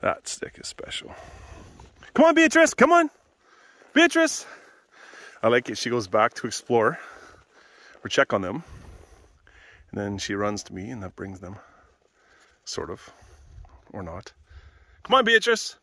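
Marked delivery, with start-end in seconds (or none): 2.1-4.4 high, loud call to the dogs; yelling. Real, not acted. Calling puppies to come along on a walk, yelling high. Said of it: "Sorry for yelling". 15.0-15.8 high, loud call to the dogs; yelling. Real, not acted. Calling puppies to come along on a walk, yelling high. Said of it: "Sorry for yelling".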